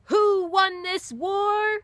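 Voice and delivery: high, slow, hammed-up sailor's shout; yelled. Sailor on a barrel yelling to the crowd, high slow shout. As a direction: bellow it from a barrel top. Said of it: "he yelled".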